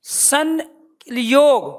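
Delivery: संयोग drawn out very slowly, ं sounded as न; slow pronunciation demo. Shown as a demonstration. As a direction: spell out संयोग slowly for the class. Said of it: "क्या बोल रहे हैं यहाँ पे प्यारे बच्चों?". (संयोग को बहुत धीरे, न की ध्वनि के साथ)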